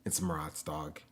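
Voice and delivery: hushed whisper. Real, not acted. Whispering so as not to wake.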